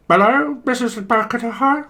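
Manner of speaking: high squawky phone voice; garbled caller. Acted. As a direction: squawk the greeting down a bad line. Garbled caller to call centre.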